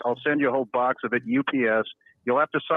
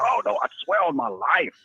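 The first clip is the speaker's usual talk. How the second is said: mock pleading; 8 semitones higher than usual. Mocking people vouching for themselves.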